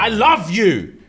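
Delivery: high, pleading declaration; mock anguished. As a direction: plead like a hurt husband. Upset husband argues with wife.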